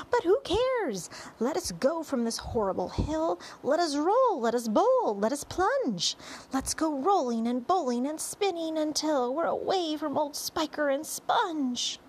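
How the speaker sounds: high, driving rhymed exhortation; rally everyone with mounting zest; rousing centipede. A narrator's reading. Centipede urging his friends to roll away, excited rallying cry.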